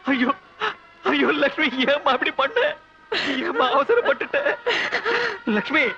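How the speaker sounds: husband grieving over the phone; anguished wail. Acted. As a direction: wail her name in despair. Husband hears wife took poison.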